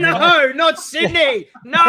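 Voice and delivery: loud, high mock outrage; mock dismay. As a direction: cry out in joking horror. Jokes about Draga being from Sydney.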